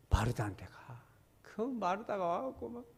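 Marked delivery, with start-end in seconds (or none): 0.1-1.0 hushed whisper, barely voiced; whispered aside. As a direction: whisper it like a secret plan. Jesus voiced in retelling. (속삭이듯 조용히)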